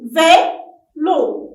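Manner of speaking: "Vélo" as two loud beats, Vé, lo; teacher's loud, separated "Vé... lo"; syllable by syllable. Shown as a demonstration. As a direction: clap out "Vélo" with a gap between syllables. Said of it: "frappez-le".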